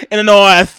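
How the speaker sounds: very loud, high mock northern accent. Imitating northerners again for laughs, northern accent. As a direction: belt it out like a cartoon northerner. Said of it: "What do they say in the north?".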